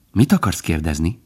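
plain reading voice

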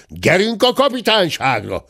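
high, rousing order; servant's shout. A narrator's reading. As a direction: call out to march him off. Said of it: "kiáltott a háziszolga".

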